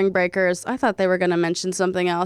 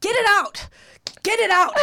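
much higher-pitched impatient yell; exasperated yelling; 11 semitones higher than usual. Real, not acted. Heckling a wordy sassy child actor, exasperated yelling. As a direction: yell it high, as if you can't wait. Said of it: "Sorry, I'm yelling".

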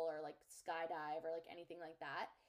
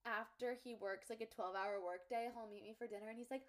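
ordinary talk, nothing marked

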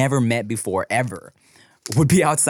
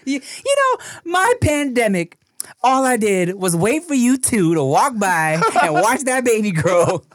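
much higher, slow, half-crying doting voice; doting neighbor; 9 semitones higher, slower than usual. Acted. Neighbor confesses watching the baby grow, doting and teary. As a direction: gush like a lonely neighbor.

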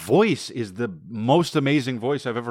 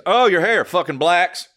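mock barber joke; 6 semitones higher, slower than usual. Imagining the barber insulting a client, put-on voice. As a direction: blurt it as a crude barber gag. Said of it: "she's like".

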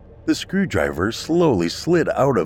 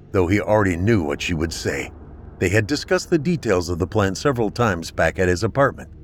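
plain reading voice

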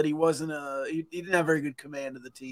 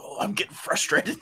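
movie line delivery; 5 semitones higher than usual. Retold or imitated. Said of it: "Like a movie line".

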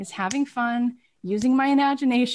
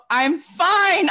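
higher, louder mock protest; exasperated insistence; 7 semitones higher, 5 dB louder than usual. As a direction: insist loudly as if waving off fuss. Retold insistence to an overcautious caller.

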